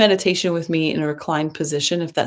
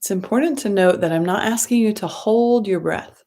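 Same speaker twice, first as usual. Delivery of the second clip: guided meditation. Acted. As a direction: ease them into the breath, gently and unhurried.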